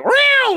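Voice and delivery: very high, loud mock meow; falsetto meow. Host mocks the film's off-screen cat, a falsetto meow. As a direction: squeak a fake cat meow. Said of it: "So you just hear".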